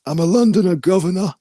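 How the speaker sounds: put-on a loud, over-the-top Cockney accent. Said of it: "I used to have a Cockney accent".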